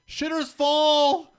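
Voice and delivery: high, slow, drawn-out shout; movie quote shout. Retold or imitated. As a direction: belt it like the movie line. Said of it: "one of Will's favorite quotes is".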